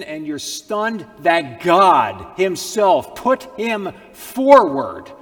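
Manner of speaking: high, loud, slow climactic preaching; preacher proclaiming Christ standing in our place; full-force preaching. Acted. Sermon climax about Christ.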